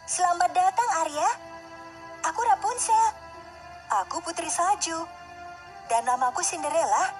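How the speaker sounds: high-pitched storybook princess voices; high, girlish round of introductions; welcoming princesses. Acted. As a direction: greet the newcomer in a high voice. Princesses greet and introduce themselves.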